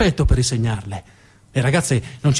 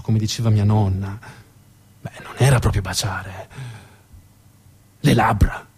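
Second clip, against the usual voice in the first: lightly voiced Pierino; 4 semitones lower than usual. Pierino telling the audience about Marta, lightly voiced and low. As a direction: confide the memory, tender and amused. Pierino recalls his first kiss.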